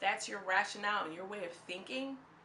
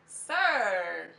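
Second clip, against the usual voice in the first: drawn-out disbelieving sir, a bit louder; exasperated sir; 3 semitones lower, 7 dB louder than usual. Retold or imitated. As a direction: call him out with weary disbelief. I'm like, exasperated at him.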